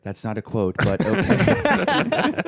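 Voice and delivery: grudging agreement, "okay" high and drawn out; imitated annoyance. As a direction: give in with exaggerated reluctance. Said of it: "So he was annoyed".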